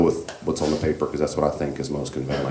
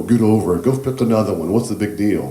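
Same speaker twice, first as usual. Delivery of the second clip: loud, dismissive scolding, a little higher; a horrible parent snapping at a child; harsh parent; 4 semitones higher, 6 dB louder than usual. Retold or imitated. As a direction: brush the child's loss off impatiently. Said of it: "horrible parents go".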